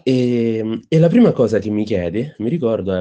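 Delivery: ordinary talk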